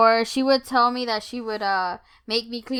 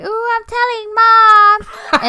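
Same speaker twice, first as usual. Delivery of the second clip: high, loud, drawn-out tattletale taunt; tattling child; 12 semitones higher, 9 dB louder, much slower than usual. Retold or imitated. Brothers threatening to tell Mom, mock tattling. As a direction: stretch it out like a gloating little brother.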